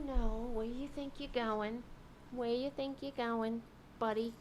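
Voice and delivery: hushed, coaxing voice to a dog; soft pet talk. Real, not acted. To the climbing dog, soft and coaxing. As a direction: gently shoo the pet off the seat. Talking softly to her pet.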